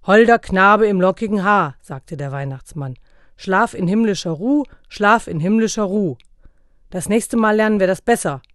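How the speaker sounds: loud, stern schoolmaster; lecturing Santa. A narrator's reading. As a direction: drill the line in.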